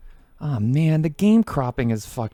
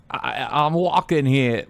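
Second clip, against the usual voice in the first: slow, halting mock New York drawl; a hint of a bad Walken voice; bad Christopher Walken impression; slower than usual. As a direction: lightly ham up a bad Walken. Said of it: "my impression of a guy doing a bad Christopher Walken impression".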